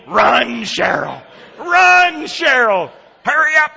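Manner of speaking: loud, high, urgent holler; mad yelling. Retold or imitated. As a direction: yell at your wife to hurry, furious. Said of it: "he keeps yelling".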